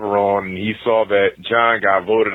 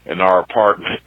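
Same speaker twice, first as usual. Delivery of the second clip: ordinary talk, nothing marked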